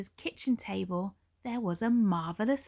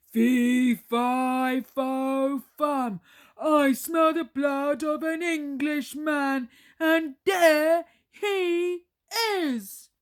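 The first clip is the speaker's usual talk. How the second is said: loud, higher triumphant roar; roaring giant; 6 semitones higher, 7 dB louder, slower than usual. Acted. Giant chasing Jack, loud triumphant roar. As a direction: roar as you spot your prey. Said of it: "The giant roared loudly".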